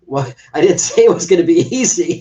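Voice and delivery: laughing. Retold or imitated. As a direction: imitate her amused reassurance. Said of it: "and she's like".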